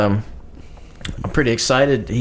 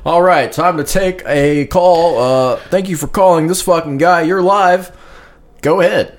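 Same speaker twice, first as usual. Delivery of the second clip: radio host voice; 4 semitones higher than usual. Acted. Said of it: "What do you think of my radio voice?".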